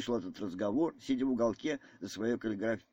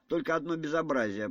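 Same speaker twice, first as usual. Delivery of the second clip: usual speaking voice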